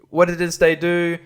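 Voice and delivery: ordinary delivery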